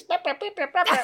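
high, silly babble for a talking ball; giggly high-pitched toy babble; kid's toy voice. Retold or imitated. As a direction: mimic a kid voicing his toy, laughing. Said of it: "It talks like this".

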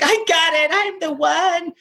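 ecstatic. Retold or imitated. Calling his agent, he blurts the news ecstatically. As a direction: blurt it out, over the moon. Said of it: "I must have sounded insane".

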